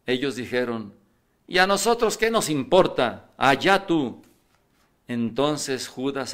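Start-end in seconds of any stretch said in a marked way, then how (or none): 1.5-4.2 dismissive retort. Acted. Sacerdotes despachan a Judas.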